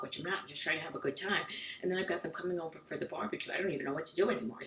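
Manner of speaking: fast, soft worried rambling; anxious inner rambling. Retold or imitated. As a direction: spiral into panic under your breath, quickly. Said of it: "You're like".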